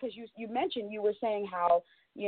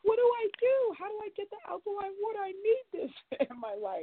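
much higher, frantic mock panic; freaking out; 12 semitones higher than usual. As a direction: whine it like a panicking customer. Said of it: "like, freaking out".

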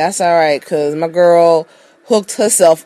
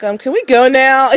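higher mock-whiny plea; mock pleading; 6 semitones higher, 3 dB louder than usual. Survivors asking Rick to let them leave, mock pleading. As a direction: imitate someone anxious to get going.

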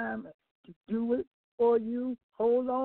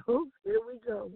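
laughing; 13 semitones higher than usual. Real, not acted. Laughing at approval.

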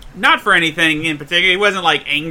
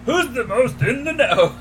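higher put-on voice, half laughing; 6 semitones higher than usual. Shown as a demonstration. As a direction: redo the line in a silly voice. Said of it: "Please shoot that in the voice again".

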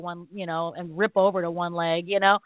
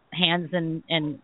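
ordinary talk, nothing marked

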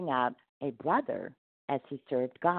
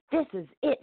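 fuming; 10 semitones higher, 3 dB louder, faster than usual. A narrator's reading. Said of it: "he fumed".

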